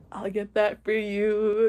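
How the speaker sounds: slow, drawn-out cutesy offer; retold gallant offer. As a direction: stretch it out, imitating a romantic lead. Imitating the helpful tall man.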